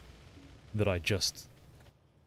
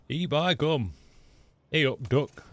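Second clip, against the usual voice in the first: broad Yorkshire phrases, pitched up; Yorkshire accent; 6 semitones higher, 3 dB louder, slower than usual. Retold or imitated. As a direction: do a comic Yorkshire greeting. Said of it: "picked up a little bit of that".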